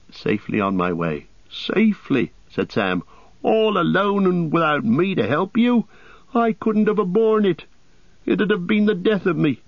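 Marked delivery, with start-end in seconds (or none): 1.5-2.3 high-pitched, incredulous repeat; indignant echo. A narrator's reading. Sam echoes the word in disbelief. 3.4-9.6 high-pitched, indignant outburst; distressed protest. A narrator's reading. Sam protests being left behind.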